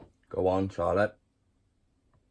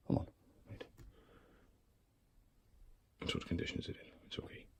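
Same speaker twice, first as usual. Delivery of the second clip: hushed whisper, barely voiced; 16 dB quieter, faster than usual. Real, not acted. Keeper whispering while checking the spider, whisper. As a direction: whisper so the spider stays calm.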